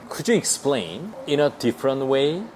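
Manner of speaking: very slow, every word spaced; teacher modelling slowly; slow demo. Shown as a demonstration. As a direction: spell it out for learners. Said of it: "이렇게".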